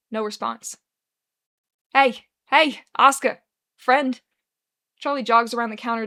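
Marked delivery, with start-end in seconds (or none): 1.9-4.2 loud urgent call to rouse him; calling loudly. Acted. Bartender calling out to a barely conscious friend, loud. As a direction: shout his name to wake him.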